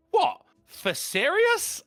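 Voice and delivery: high, loud shocked "What?" then a slow "For serious?"; a mother's high, incredulous reply at dinner. Retold or imitated. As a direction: mum shocked at the dinner table. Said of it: "she replied, incredulous".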